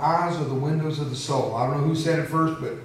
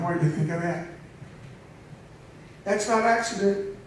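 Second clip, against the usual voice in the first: usual speaking voice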